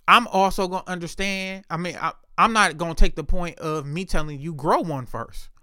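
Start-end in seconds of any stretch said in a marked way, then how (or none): none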